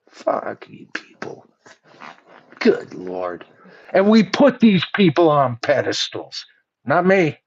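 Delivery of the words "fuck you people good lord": whispered disgust. Real, not acted. Muttered aside in disgust.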